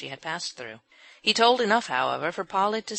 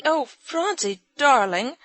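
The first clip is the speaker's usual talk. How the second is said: high, loud loving cry; tender cry; 9 semitones higher, 8 dB louder, slower than usual. A narrator's reading. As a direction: rush to embrace the child. Mother figure greets child.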